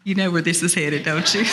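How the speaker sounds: ordinary delivery, nothing marked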